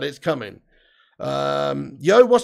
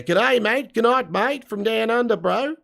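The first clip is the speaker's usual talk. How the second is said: higher-pitched hammed-up Aussie greeting; mock Australian accent; 4 semitones higher, slower than usual. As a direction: put on a broad Australian accent. Mimics Australians after Australian viewer's greeting.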